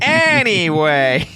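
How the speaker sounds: long, high, sing-song "Anyway"; awkward drawn-out anyway. Real, not acted. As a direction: stretch it out to change the subject.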